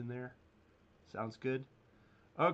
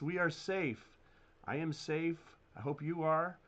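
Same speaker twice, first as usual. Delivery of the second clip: ordinary talk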